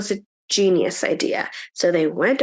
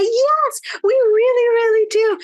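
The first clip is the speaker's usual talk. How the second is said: put-on eager girls; 12 semitones higher, 4 dB louder than usual. A narrator's reading. Girls eagerly accept gifts.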